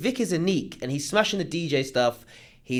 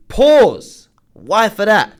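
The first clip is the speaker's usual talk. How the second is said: louder, higher sudden exclamation; loud outburst; 5 semitones higher, 12 dB louder, much slower than usual. Real, not acted. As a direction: burst out at the comment. Reacting to a shocking comment.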